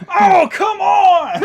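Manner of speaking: high, loud, drawn-out groan of protest; slow hammed-up outcry of annoyed customers; exasperated shout. Waiting customers protesting the slow spell, loud exasperated shout. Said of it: "the people go".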